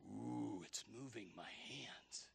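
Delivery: low, hushed mock wonder; eerie mock whisper; imitated spooked whisper. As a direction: whisper it like a séance player in awe. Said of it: "they'll get down there and they'll say".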